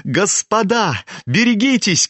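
high, alarmed call; boy's urgent cry, pitched high; warning cry. A narrator's reading. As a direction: call out a warning, stretching the first word. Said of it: "крикнул Коля".

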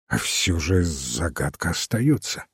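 low, soft, musing; slow, pensive start. A narrator's reading. Detective still faces a riddle, speaking low and pensively. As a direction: begin softly, still puzzling it over. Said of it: "сыщик медленно заговорил".

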